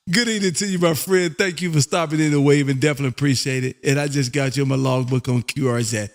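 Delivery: usual speaking voice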